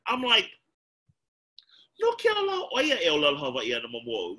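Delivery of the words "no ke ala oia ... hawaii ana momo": fast, put-on fluent Hawaiian phrase; mock fluent Hawaiian. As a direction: rattle off Hawaiian like a native. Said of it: "Well, I didn't say it like that".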